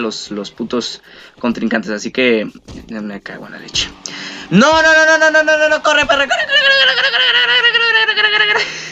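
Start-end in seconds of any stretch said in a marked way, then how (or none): 4.5-8.9 high panicked squealing; frantic shrieks to run; being chased. Real, not acted. Fleeing Big Show in game.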